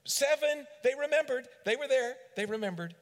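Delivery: high, loud eager answer; eager shout. Acted. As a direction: shout it like a proud disciple. Disciples answering eagerly again.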